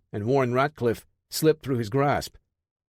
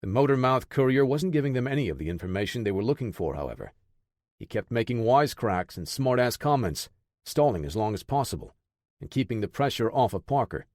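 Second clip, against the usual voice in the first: plain reading voice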